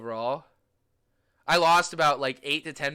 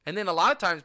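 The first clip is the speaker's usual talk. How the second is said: normal talking voice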